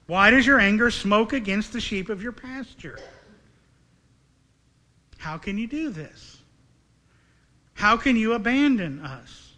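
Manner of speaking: mildly acted, bewildered plea to God; a touch of baffled lament; confused protest. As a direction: press each question like a hurt appeal, pausing between. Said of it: "He's confused".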